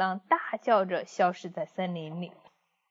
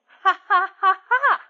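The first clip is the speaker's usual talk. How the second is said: loud, higher, slow gloating laugh; hammed-up evil wolf laugh; villain laugh; 11 semitones higher, 7 dB louder, slower than usual. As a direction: laugh like he's already won.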